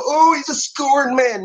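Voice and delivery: very high, drawn-out mocking voice; mocking critic. Answering his critics by mimicking them, high mocking voice. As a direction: mimic a dismissive critic in a high, slow sing-song.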